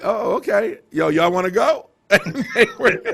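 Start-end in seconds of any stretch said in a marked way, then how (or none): 0.0-1.9 high, loud eager invitation; retelling asking his crew along, thrilled and high; excited retold invite. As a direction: pitch it up like breaking big news to friends. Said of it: "I was like".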